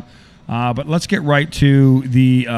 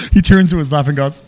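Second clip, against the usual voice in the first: usual speaking voice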